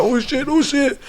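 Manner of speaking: high, hushed mock alarm. Man acts out a startled reaction, high and hushed. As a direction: gasp it out high and small.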